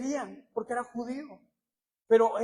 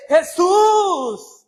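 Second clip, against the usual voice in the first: full-voiced shout, much louder; 7 semitones higher, 16 dB louder than usual. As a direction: cry out with all your strength. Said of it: "él clamaba aún mucho más fuerte". (grito a todo pulmón)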